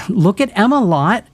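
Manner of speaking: high, bright call across the pool; teasing call to a friend at the pool. A narrator's reading. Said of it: "Jules called".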